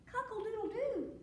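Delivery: high mock crowing; rooster crow. A narrator's reading. Storyteller as the cat who caught the crow, rooster crowing. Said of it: "making the noise of the rooster".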